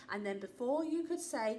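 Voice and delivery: usual speaking voice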